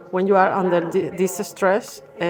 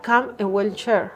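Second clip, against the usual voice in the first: ordinary delivery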